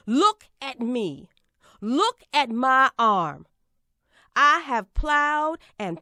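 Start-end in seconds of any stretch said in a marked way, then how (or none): 0.1-3.5 slow, with a heavy hit on Look; measured, commanding appeal; slow emphatic command. Acted. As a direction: make them look at you, word by word. Orator demands the crowd look.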